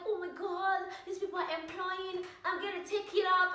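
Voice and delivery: high, eager imitation of an excited woman. Speaker mimics woman thrilled about a new job, excited. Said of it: "she was so excited".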